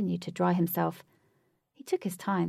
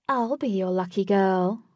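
flirty; 6 dB louder than usual. Acted. Christy Ann flirting.

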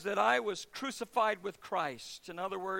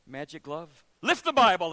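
mock magic command; 3 semitones lower, 8 dB louder than usual. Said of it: "He goes".

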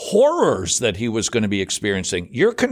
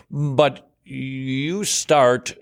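ordinary talk

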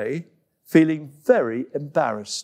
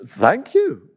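high, loud tearful gasp of thanks; monkey overwhelmed by a kind gift; cried out; 10 semitones higher, 4 dB louder, slower than usual. Acted. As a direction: burst out in grateful surprise. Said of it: "cried Coco".

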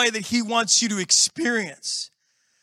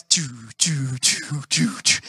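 low, lightly voiced car-engine noise; mock choo-choo engine sound; imitated engine chugging; 8 semitones lower, slower than usual. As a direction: mimic a car straining to cut in. Imitating a car trying to cut in.

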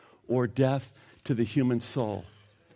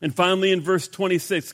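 ordinary talk, nothing marked

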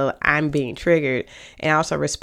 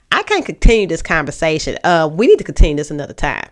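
curt, louder, annoyed and fast; with an attitude; 5 dB louder, faster than usual. Shown as a demonstration. Cutting off a partner mid-argument, curt and louder with attitude. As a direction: snap it off like they get on your nerves. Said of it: "The other one was, I got an attitude".

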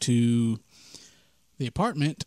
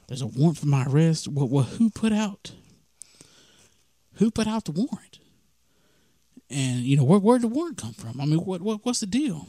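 higher, rising, frantic questions; panicked questions; 3 semitones higher than usual. Retold or imitated. To himself in the police car, panicking higher. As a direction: voice climbing as the panic sets in. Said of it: "I'm just freaking out".

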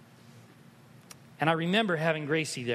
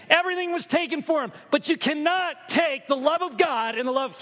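loud, high, fervent preaching; impassioned sermon cry; preaching at full force; 11 semitones higher, 4 dB louder than usual. Acted. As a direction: build it to a passionate climax.

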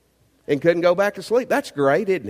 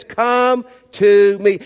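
slow, louder, drawn-out come to me; emphatic invitation; 3 semitones higher, 7 dB louder, much slower than usual. Acted. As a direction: lean on every word. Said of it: "Jesus says".

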